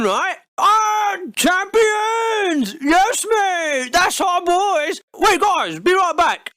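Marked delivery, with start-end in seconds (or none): 0.6-5.0 higher mock victory shout; hammed-up cheering supporter; celebrating fan. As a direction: couch fan celebrating the win, slow at the end. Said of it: "But as soon as you win".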